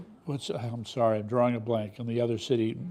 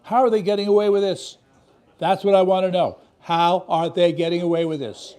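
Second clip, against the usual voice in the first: loud, higher indignant question; outraged; 5 semitones higher, 6 dB louder than usual. Real, not acted. Host demanding answers from viewers, outraged. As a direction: demand it in disbelief.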